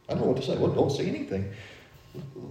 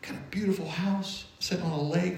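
whisper; 4 semitones higher, 7 dB quieter than usual. Real, not acted. Describing friends' lake house.

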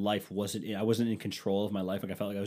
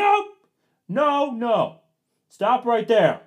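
much higher and louder, cutting her off; retold shout; 11 dB louder, much slower than usual. Husband stopping wife mid-fight, loud retold shout. Retold argument, his line.

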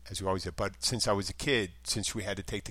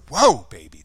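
loud reaction; 16 dB louder than usual. Real, not acted. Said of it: "that was a good one".

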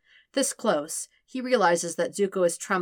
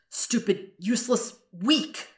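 self-loathing; 5 semitones higher, slower than usual. Acted. Zuko berating himself.

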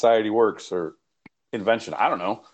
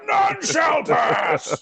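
slow, high, booming mock wizard shout; much slower than usual. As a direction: roar it like a wizard barring the bridge. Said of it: "I said".

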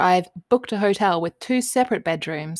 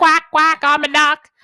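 put-on high, loud quacking toddler; duck voice; 9 semitones higher, 7 dB louder than usual.

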